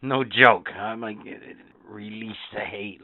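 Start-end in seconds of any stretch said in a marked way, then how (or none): 1.9-3.0 Sith Lord voice. Retold or imitated. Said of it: "like it's a Sith Lord".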